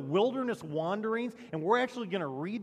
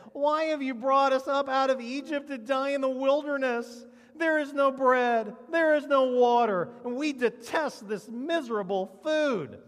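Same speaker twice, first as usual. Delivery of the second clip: whining mock protest, pitched high and held; whiny voice; 7 semitones higher, 6 dB louder than usual. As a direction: complain in a hammed-up whiny voice. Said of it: "That was my best whiny voice".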